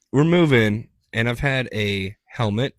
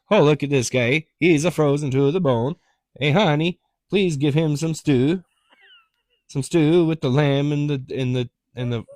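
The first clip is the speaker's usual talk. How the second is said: higher, louder hammed-up hospitable host; mock old-time host; 5 semitones higher, 5 dB louder than usual. Host urging his wife to feed a frozen stranger, hammed up. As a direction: play the welcoming old-world host. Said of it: "it'd be all like".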